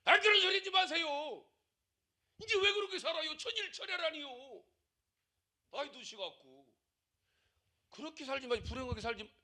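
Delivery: a hammed-up fussing church elder; imitating a scolding congregant. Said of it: "나한테 그러더라고".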